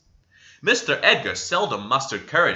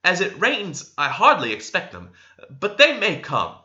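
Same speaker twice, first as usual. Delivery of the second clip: Cathy; 6 semitones higher than usual. A narrator's reading. Cathy warns Heathcliff.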